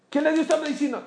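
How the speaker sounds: pregunta repetida con alarma, muy aguda; alarmado. Retold or imitated. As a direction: sube mucho el tono, escandalizado. (pregunta alarmada)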